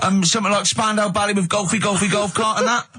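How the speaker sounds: high, daft radio caller voice; high-pitched mock phone-in contestant; eager phone-in caller. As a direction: offer it up as a keen punter. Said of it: "Derek, what is it?".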